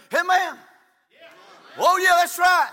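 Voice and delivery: shouted response from the pews; hearty amen-corner holler; congregation's amens. Acted. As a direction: call out agreement to the preacher. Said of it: "there ought to be a few people say".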